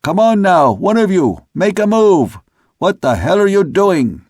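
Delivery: high, loud sailor's holler; bellowed high at the crew; second mate calling. A narrator's reading. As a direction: holler an impatient order up the deck. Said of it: "The second mate's voice came again".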